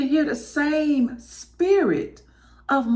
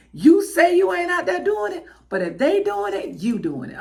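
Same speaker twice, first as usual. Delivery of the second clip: high-pitched imitation of a lecturing mama; mock motherly scolding, pitched up; 6 semitones higher than usual. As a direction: mimic mama catching you out. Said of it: "my mama used to say this all the time".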